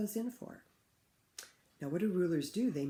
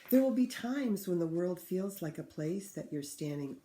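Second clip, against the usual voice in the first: put-on clear, louder read-aloud for children; story reading; 6 dB louder than usual. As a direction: read the book to the class with feeling. Teacher reads picture book aloud.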